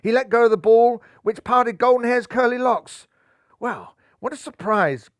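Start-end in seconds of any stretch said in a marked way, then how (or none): 3.6-5.1 gasped. A narrator's reading. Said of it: "gasped the Bunbury skipper".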